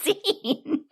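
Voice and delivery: high, slow, laughing disbelief; baffled, laughing at the scene; laughing confusion. Retold or imitated. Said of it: "And I'm like".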